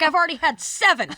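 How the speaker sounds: high, loud tipsy party call; drunk party host. Retold or imitated. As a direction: shout it out like a buzzed hostess offering shots.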